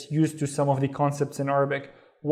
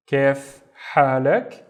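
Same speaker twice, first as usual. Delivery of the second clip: Kef halak word by word, drawn out; slow model phrase; 2 semitones lower, 4 dB louder, much slower than usual. Shown as a demonstration. Teacher reviewing "how are you", Kef halak slowly.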